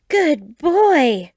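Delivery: high, loud cheer; delighted praise. A narrator's reading. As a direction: cheer him on, thrilled. Praising Pokemon after win.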